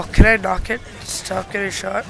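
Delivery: ordinary talk, nothing marked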